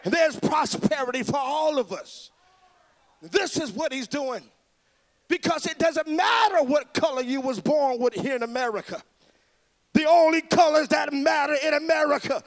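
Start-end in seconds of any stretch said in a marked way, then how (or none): 5.3-12.4 fiery preacher rousing the crowd; preaching at full force. Real, not acted. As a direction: preach it loud and rising to the crowd.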